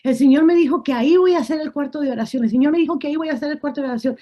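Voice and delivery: anuncio atropellado y emocionado; repítelo rápido, con ilusión contenida a duras penas; contenta. Retold or imitated. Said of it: "Y yo más contenta". (contándolo contenta y a toda prisa)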